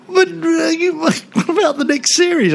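high, pleading with a laugh breaking in; pleading producer. Retold or imitated. Producer begs the star about the next series, high and pleading. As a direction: beg in a high laughing whine.

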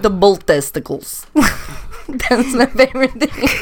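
playful mock Russian accent. Shown as a demonstration. Answering co-host's praise, mock Russian accent. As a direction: slip into a put-on Russian accent. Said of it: "You do a really good Russian one".

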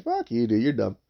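easygoing, unbothered put-down; casual ribbing. Shown as a demonstration. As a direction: toss it off like trash talk, no anger. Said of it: "It's more of, like".

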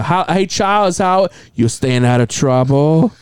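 imitated Chinese accent, drawn-out question; Chinese doctor. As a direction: mimic a doctor's slow Chinese-accented check-in. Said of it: "my Chinese".

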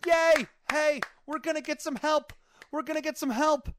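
high, loud mock crowd cheer. Camp cheering the help plane, high excited cheer. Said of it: "everybody's outside cheering".

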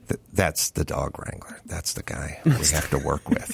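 low, soft voice of the crew member; a hint of someone breaking bad news quietly; lightly voiced crew member. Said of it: "comes up and goes".

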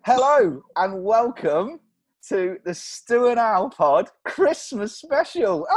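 slow, loud, high announcer welcome; hammed-up host intro. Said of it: "mock grand show intro".

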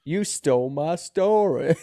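slow, loud, drawn-out accusation; imitated menacing accuser. Film stranger accuses the writer, imitated menacing drawl. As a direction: stretch each word in a put-on character voice.

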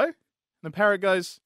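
ordinary talk

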